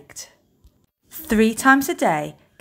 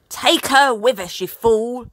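higher, louder pirate bellow; bellowing captain; 4 semitones higher, 4 dB louder than usual. Acted. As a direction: bark the order at the fool. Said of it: "Captain Firebeard bellowed".

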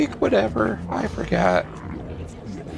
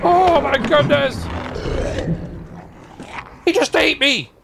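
panicked high yelps; frantic, fleeing a monster; being chased; 7 semitones higher than usual. Real, not acted. Panicked while chased in game.

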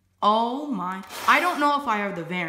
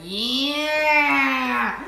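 wrestler yell; 4 dB louder than usual. Retold or imitated. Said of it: "He's like".

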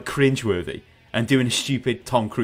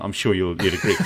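ordinary talk, nothing marked

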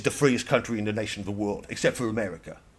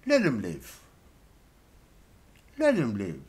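mock royal mercy. Royals looking through the TV screen, granting mercy in a mock solemn voice. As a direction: hand down a gracious pardon from the throne. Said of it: "they look at me and they're like".

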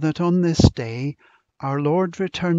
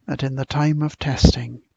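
public prayer; 5 dB louder than usual. Acted. Minister leading the congregation in prayer, earnest and raised. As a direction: lift each petition up to God for the gathered church.

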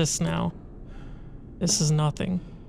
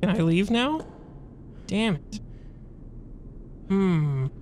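ordinary talk, nothing marked

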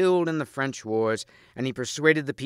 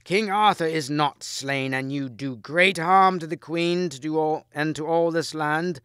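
put-on higher, slower voice of an old priest; lightly voiced old archbishop; 5 semitones higher, 4 dB louder than usual. A narrator's reading. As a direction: rebuke him as a frail old man. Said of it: "a very old man".

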